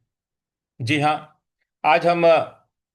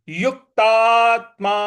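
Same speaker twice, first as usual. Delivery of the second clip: युक्तात्मा with युक pushed higher and louder; stress on युक; 5 semitones higher, 5 dB louder, slower than usual. Shown as a demonstration. Teacher shows students where the force goes. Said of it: "युक पे भी हमको बल लगाना है".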